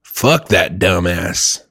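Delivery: low, slow dismissal; comic low-voiced put-down; Jesus. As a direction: drop the voice and slowly write someone off. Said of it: "And then Jesus is just like".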